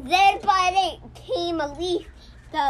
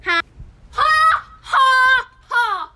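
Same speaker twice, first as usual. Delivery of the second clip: mock laugh; 10 semitones higher, 7 dB louder than usual. Kid mocking laugh.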